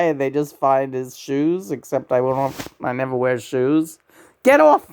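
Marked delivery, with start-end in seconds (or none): 4.4-4.9 high, loud, short burst; shouted command. Real, not acted. Telling someone to get off, shouted. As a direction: shout it as a sudden order.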